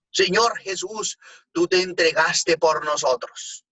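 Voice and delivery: slow, loud, high cry of a churchgoer; a voz en cuello. Retold or imitated. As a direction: imitate a woman calling out her prayer at full voice. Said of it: "a voz en cuello dijo". (a voz en cuello, imitando a una señora que clama despacio y alto)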